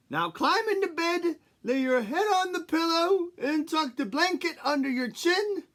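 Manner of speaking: high, loud doting granny; grandma voice. Acted.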